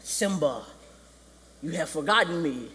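solemn, weighty, measured voice; Mufasa. Retold or imitated. Father appears to Simba from the sky, solemn. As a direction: intone it like a ghostly king. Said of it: "Mufasa comes out of the sky".

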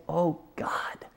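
breathy, tearful "Oh, God"; whispered prayer while crying. Retold or imitated. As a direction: whisper it through tears. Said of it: "literally crying and just saying".

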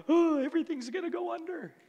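high, put-on wail of gloom; mock doom-saying. Preacher imitating others who lack faith, mock doom. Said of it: "others are going".

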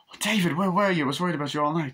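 mock anxious scolding; worried girlfriend. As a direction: imitate a girlfriend who has waited all night. Girlfriend voiced, worried about David.

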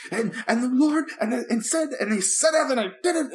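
high, fervent preacher impression, every phrase rising; mock a pastor who is pumping up the crowd; imitating a televangelist's preacher voice, pitched high. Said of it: "major preacher voice".

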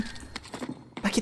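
breathy whisper, enemy close. Real, not acted. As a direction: whisper it so nobody hears.

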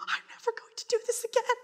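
high, whimpering vow; self-pity; whiny vow. Retold or imitated. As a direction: whimper a promise. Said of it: "I'm just like".

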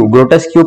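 ग्रोटेस्क्यू with a false "kyoo" ending; mispronounced ग्रोटेस्क्यू. Shown as a demonstration. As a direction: say ग्रोटेस्क्यू as students misread it, ending "tes-kyoo". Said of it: "pronunciation नहीं होती".

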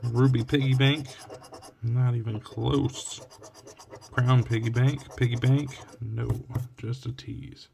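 usual speaking voice

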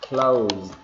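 "Closed" with a voiced -zd ending; final D sound. Shown as a demonstration. Teacher repeating the word for learners, final D sound. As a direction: end "Closed" on a D, one syllable. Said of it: "Ending sound nó là âm D".

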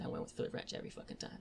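unvoiced, hushed confession; grim whisper. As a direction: breathe it out, haunted.

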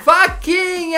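faquinha said high and sing-song. Pretend. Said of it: "diga faquinha".

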